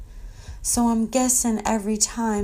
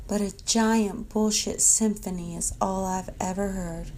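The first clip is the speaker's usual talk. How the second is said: normal talking voice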